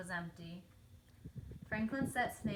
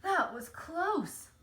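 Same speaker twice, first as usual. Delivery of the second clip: slow, breathless and higher; panting; 7 semitones higher, 6 dB louder, much slower than usual. A narrator's reading. Beaver out of breath after fleeing the wasps, panting. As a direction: gasp it out after the sprint. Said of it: "panted Beaver".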